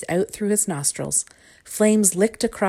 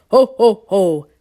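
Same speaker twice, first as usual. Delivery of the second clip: very loud belly-laughing dragon; big, round monster guffaw; booming laugh; 3 semitones higher, 10 dB louder, slower than usual. A narrator's reading. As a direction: boom out each laugh. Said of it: "boomed the dragon".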